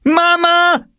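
very high, loud shriek for mother; screaming. A narrator's reading. Furious girl summons mother against brother, screaming. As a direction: scream it out as an outraged tattletale. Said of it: "schrie Jule".

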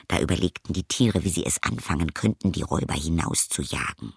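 usual speaking voice

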